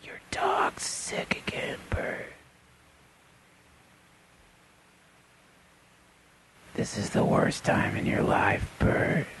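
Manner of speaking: eerie whispering voice of the ego creature; hissed creature whisper; creepy whisper. Acted. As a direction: whisper it like a monster from the woods. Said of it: "that's the thing that says".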